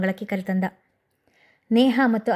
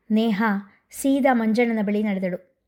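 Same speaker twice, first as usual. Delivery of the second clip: plain reading voice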